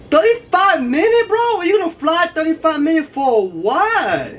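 can't believe the number; incredulous. Real, not acted. Disbelief at the flight time.

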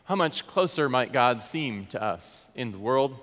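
ordinary talk

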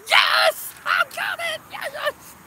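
high, loud cheering; triumphant shout. Real, not acted. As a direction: burst into a victory cheer. Celebrates again mid-thanks.

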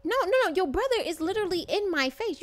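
indignant protest. Acted. Arguing with the brother in his face.

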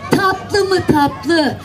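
usual speaking voice

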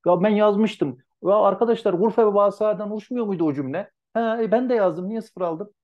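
high, loud mock complaint from the class; whiny student protest. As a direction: whine like students who feel cheated. Sınıfın itirazını canlandırıyor. (haksızlığa uğramış öğrenciler gibi, yüksek perdeden sızlanarak)

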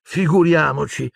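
high-pitched exasperated outburst; snapping Hermione. A narrator's reading. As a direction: burst out scoffing, pitched high. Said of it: "sbottò Hermione". (sbottando, acuta e spazientita)

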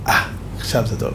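soft, whispered and low; a quiet whisper of satisfaction; hushed approval. Retold or imitated. The Baal Shem Tov quietly approving the humbled slaughterer, whispered.

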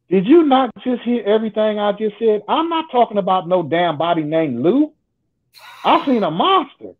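high-pitched, incredulous retort; exasperated protest. Retold or imitated. Man correcting the woman who misheard his monster story, exasperated. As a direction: push it up high in mock exasperation.

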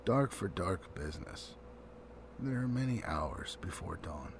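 hushed, secretive dwarves, trailing into a whisper; conspiratorial whisper in a dark room; ominous dwarf whisper. A narrator's reading. Dwarves answering grimly in the dark.

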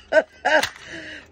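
high, loud rueful laugh. Real, not acted. Laughing at her own bad roll, rueful. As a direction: laugh at your bad luck.

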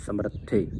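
ਸਮਰੱਥੇ with a held, doubled tth; ਸਮਰੱਥੇ pressing on the addak; ਸਮਰੱਥੇ said quietly with a long tth; doubled consonant. Shown as a demonstration. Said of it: "ਇਹਨੂੰ ਇਸ ਤਰ੍ਹਾਂ ਬੋਲਣਾ ਹੈ".

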